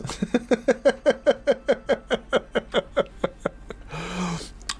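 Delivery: long high giggle; laugh. Real, not acted. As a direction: laugh at your own joke. Laughs at own accent bit.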